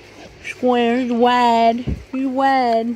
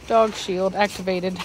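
ordinary talk, nothing marked

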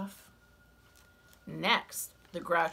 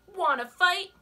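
high, loud, grumpy bug; grouchy ladybug voice; 12 semitones higher, 9 dB louder than usual. Acted. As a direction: bark the challenge up high. Ladybug challenges flipper.

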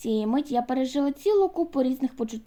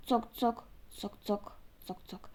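ticking sound; 3 semitones lower, 11 dB quieter, slower than usual. A narrator's reading. Imitating the ticking clock.

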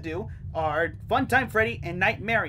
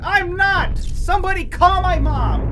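panicked yelling; 10 semitones higher, 8 dB louder, slower than usual. Real, not acted. Panicked boast then plea.

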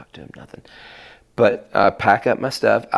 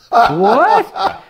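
laughing disbelief; 6 dB louder than usual. Retold or imitated. Retold shock at being band leader.

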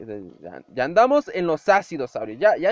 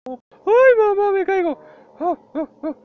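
high yelp, much louder; mock panic; 13 semitones higher, 7 dB louder than usual. Real, not acted. Gamer yelping as his character falls. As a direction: squeal as you fall. Falling in game, yelling.

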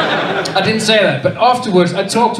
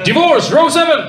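loud, higher psychic announcement; mock psychic; 8 semitones higher, 5 dB louder than usual. Comedian as a psychic medium calls out to the audience, hammed-up announcement. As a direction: call it out to the room like a medium.

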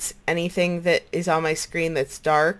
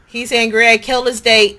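louder alarmed cry; 4 semitones higher, 7 dB louder than usual. Real, not acted. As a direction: blurt it in alarm. Alarmed that enderman is angry.

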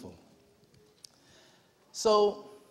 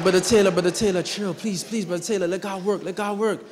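louder hushed urgent calming; urgent pleading; 3 semitones higher, 8 dB louder than usual. Retold or imitated. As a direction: plead with him to calm down, loudly. Calming the shouting Brother Taylor.

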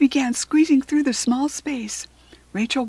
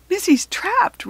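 high, louder, drawn-out cry of dismay; 9 semitones higher, 5 dB louder, slower than usual. A narrator's reading. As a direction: cry it out in alarm. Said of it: "Rachel cried".

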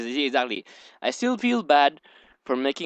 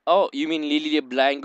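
ordinary delivery

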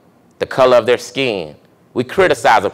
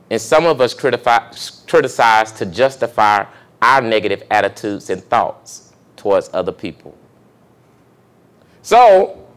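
ordinary delivery

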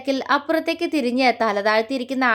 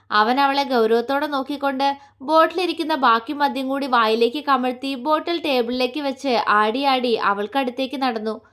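plain reading voice

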